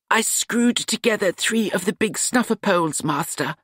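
eager to show his fix; young apprentice Nut. A narrator's reading.

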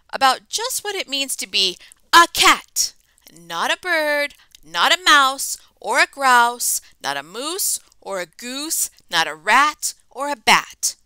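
a touch of a loud rhythmic scolding; lecturing mother. Acted. Mother lecturing her kitten, mildly loud. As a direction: tick off each animal like a lecture. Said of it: "The lecture went on".

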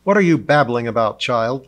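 loud, quick, irritated snap; snapped. A narrator's reading. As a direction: snap impatiently at a child. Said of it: "Lionheart snapped".

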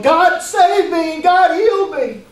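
high, drawn-out shout of praise; acted-out loud, slow exultant call; imagined jubilant outcry. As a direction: cry it out as if running back healed. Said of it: "I can imagine running back going".

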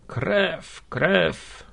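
high, drawn moan, lightly acted. A narrator's reading. Wounded worker moaning over his bleeding, understated groan. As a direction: moan each word apart as if in pain. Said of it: "jęczał ranny".

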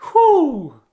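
ordinary talk

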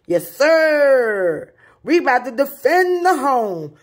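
high, loud, rallying the troops; hyped-up battle cry. Pretend. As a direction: shout it like going into battle against bugs. Hyping home defense.

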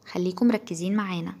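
normal talking voice

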